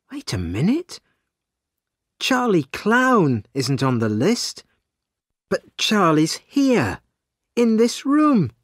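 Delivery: puzzled, climbing to high astonishment; rising pitch as the penny drops; dawning realisation. A narrator's reading. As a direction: build from a questioning thought to a startled discovery. Sally realises an extra character.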